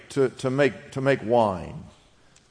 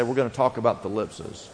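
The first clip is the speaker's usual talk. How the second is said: quiet prompt; 6 semitones lower, 7 dB quieter, faster than usual. Shown as a demonstration. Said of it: "Just say".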